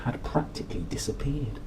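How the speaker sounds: whispered dramatic reveal; hushed whisper. A narrator's reading. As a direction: whisper it like a secret. Dramatic narration.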